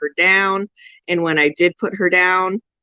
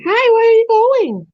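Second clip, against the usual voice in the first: pet talk; 14 semitones higher, 5 dB louder than usual. Real, not acted. Owner calling after her wandering pet in high baby talk. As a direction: coo at the little pet as it wanders off.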